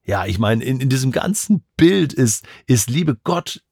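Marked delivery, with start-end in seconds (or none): none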